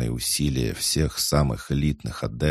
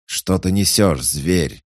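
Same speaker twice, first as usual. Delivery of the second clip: loud, higher angry bark; barking shout; 6 semitones higher, 6 dB louder, slower than usual. Acted. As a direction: bark it out at the orc. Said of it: "рявкнул один из офицеров".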